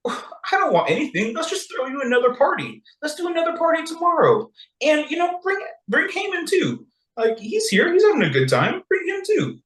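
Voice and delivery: high, breezy, innocent party plans; coy Esther. Retold or imitated. Esther coyly inviting the king and Haman again. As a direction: play it sweet while setting a trap. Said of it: "Esther says".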